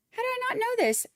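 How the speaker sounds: very high, stress on "not"; excited disbelief. Real, not acted. Streamer repeats her disbelief to the chatter, stressing not. Learns a viewer's birthday.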